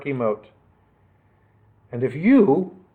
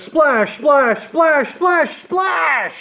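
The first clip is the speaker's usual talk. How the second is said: higher each splash; rising splashes; 12 semitones higher, much slower than usual. A narrator's reading. Storyteller sounds the splashes, climbing ever higher.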